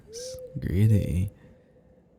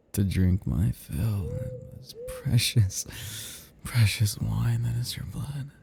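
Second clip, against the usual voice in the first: normal talking voice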